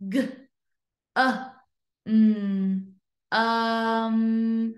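"G-", "uh", "mm" apart, then held "um"; sounded out. Shown as a demonstration. Teacher breaking gum into sounds, sounded out. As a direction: separate each sound of gum, then blend the end. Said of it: "I hear a short U sound".

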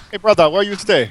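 lightly put-on Hawaiian pidgin; a hint of island pidgin; mock pidgin.

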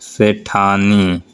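very slow, the ending drawn out; teacher's slow model of a word; slow demo word. Shown as a demonstration. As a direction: stretch Sethani so the ending is heard. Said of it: "जैसे सेठ का होता है".

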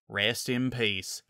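slow, soft solemn blessing. A narrator's reading. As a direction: say it slowly and gently, like a rite.

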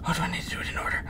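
unvoiced whisper to self. Real, not acted. Player puzzling over a puzzle, whispered aside. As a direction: whisper the question nervously.

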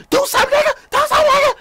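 very high, shouted taunt; mock-shriek it like an in-your-face dare; going off, imitated. Woman all in a man's face on the train, imitated shrieking. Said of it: "that chick was going off in the mouth".

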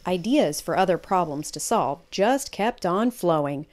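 usual speaking voice

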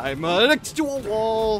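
hammed-up loud, high, drawn-out cry; mock wailing. Acting out laying low.

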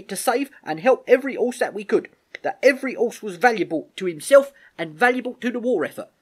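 put-on high, loud, impassioned young soldier; David speaking up. David reminding the Major every horse is valuable, high and insistent. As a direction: argue the case hard in a colloquial soldier's voice. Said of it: "David spoke up now in support".

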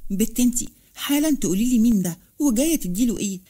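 ordinary delivery

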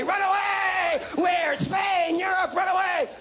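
high shouted run away; panicked shout. Retold or imitated. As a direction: yell it like a scared kid fleeing. Kid panicking after shooting brother.

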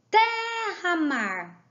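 "Terra-mar" very slow, TER drawn out; stressed syllable demo. Shown as a demonstration. Teacher showing pupils the stressed syllable, TER held long in "Terra-mar". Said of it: "O té é mais forte".

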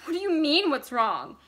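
high, loud, thin rising voice; wife snapping back at husband, thin and high; voice rising thinly. Acted. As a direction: voice climbs tight and thin. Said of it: "her voice rising thinly".

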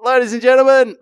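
loud, high announcer's build-up, stretching the name; stage MC introduction. Retold or imitated. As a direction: hype the next act like a club MC. Retells introducing the next act.

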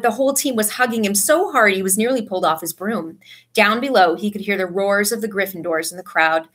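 ordinary delivery, nothing marked

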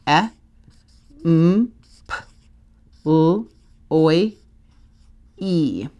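each letter of employee named alone, with pauses between; letter by letter spelling. Shown as a demonstration. Teacher spells employee aloud with the class, letter by letter. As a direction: spell it out one letter at a time. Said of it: "Say as you write".

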